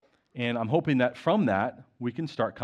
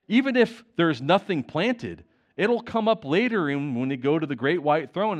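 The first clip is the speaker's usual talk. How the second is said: preaching; 4 semitones higher than usual. Acted. Preacher urging the church to share testimony, fervent and slow. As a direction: press the point home to the flock.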